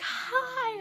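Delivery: very high, drawn-out squealed hi; high-pitched mock fangirl-style greeting; retold excited greeting. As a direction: imitate her sing-out hello way up high.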